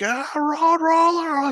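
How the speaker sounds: high, slow villain shout; Dio shouting. Retold or imitated. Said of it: "instead of shouting".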